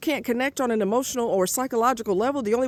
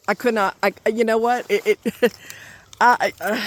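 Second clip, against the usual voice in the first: flustered, broken restarts; stuttering. Real, not acted. Too angry to retell what he said, stuttering. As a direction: trip over the first words, restarting. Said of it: "I'm stuttering".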